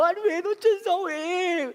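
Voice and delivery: high, loud crying wail; wife crying on the phone over the missed flight; imitated sobbing wife. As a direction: wail like a heartbroken girlfriend. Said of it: "제 아내가 막 울기 시작하는 거예요".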